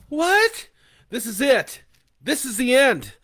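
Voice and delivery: high, loud, despairing cry; terrified. Acted. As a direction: Leah grasping the end of the world, high and terrified. Leah in panic.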